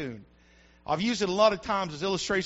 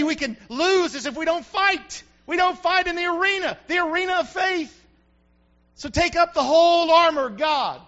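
higher, shouted call; preaching shout; 8 semitones higher, 4 dB louder than usual. Real, not acted. Preacher shouting his call to fight. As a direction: cry it out over the church.

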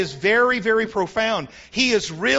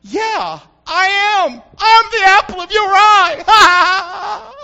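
much higher and louder, laughing cry; a jubilant, laughing declaration to God; exultant shout; 9 semitones higher, 12 dB louder, much slower than usual. Acted. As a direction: shout it out with joy. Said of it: "come into agreement with him and say".

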